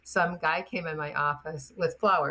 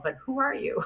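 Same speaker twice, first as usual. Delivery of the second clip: amused surprise; 8 semitones higher than usual. Retold or imitated. Teller mocks her own surprise.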